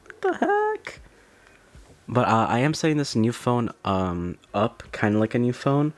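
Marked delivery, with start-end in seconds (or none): 0.0-1.0 much higher, squealed, almost falsetto; high-pitched squeal of amazement; squeaky amazed exclamation. Real, not acted. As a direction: squeak it out in disbelief.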